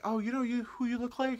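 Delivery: high mimicked stranger's voice; imitated nosy stranger. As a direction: pitch it up as an eager stranger. Mimics people who insult him.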